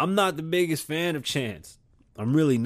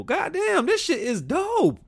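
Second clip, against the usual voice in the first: very high, slow self-hype; mock hype; slower than usual. Vlogger hyping his own imaginary single. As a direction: gush over your own track.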